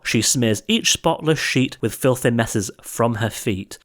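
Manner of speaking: ordinary delivery, nothing marked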